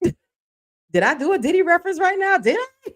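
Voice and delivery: delighted disbelief at her own joke; laughing. Real, not acted. Catches own [name] reference.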